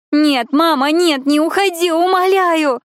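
high, loud desperate wailing; screaming sobbing. Acted. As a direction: sob and scream it out. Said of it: "она закричала, рыдая во всё горло".

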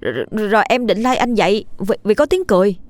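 scared, stumbling objection; agitated protest. Acted. Frightened boy disputes friend's account.

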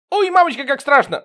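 high, loud terrified wail; hammed-up scared howl; howling in fright. A narrator's reading. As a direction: yelp it in panic. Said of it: "взвыл Серёга".

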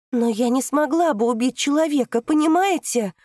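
high, loud pleading; girl insists she couldn't kill, high and loud; loud plea. Acted. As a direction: beg them to understand, almost shouting. Daughter pleads loudly.

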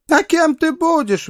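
very high, sharp challenge; indignant interruption. A narrator's reading. Mother breaking in on her daughter's suitor, indignant and high-pitched. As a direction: cut in with a high, scornful question. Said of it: "вмешалась мадам Уалл".

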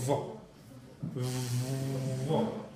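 Vo after a long buzzed V, almost F; labiodental V. Shown as a demonstration. Teacher demonstrating the letter almost like F, prolonged buzzing Vo. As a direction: hold the vvv on the lip, then release Vo. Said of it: "otra es labio dental. Casi F".

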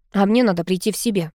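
ordinary delivery

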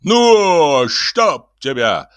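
drawn-out curse that starts high and loud; loud burst, slowing and dropping; exasperated cursing. A narrator's reading. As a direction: swear at a stubborn horse. Cursing the balking horse.

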